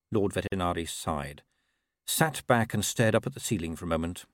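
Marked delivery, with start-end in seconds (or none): none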